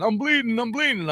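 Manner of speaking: put-on high, frightened cry of a hurt kid; panicked yelling. Teen passenger yelling after the crash, high panicked cry. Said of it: "he was yelling".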